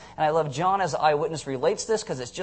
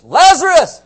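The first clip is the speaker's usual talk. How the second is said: huge high shout of the name; shouted call; 12 semitones higher, 16 dB louder, slower than usual. Shown as a demonstration. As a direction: bellow Lazarus across the graveyard. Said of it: "So it's like this".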